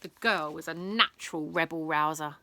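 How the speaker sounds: usual speaking voice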